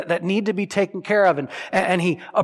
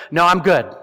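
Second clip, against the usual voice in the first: mock breezy dismissal; 5 dB louder than usual. Mocking the man leaving the mirror.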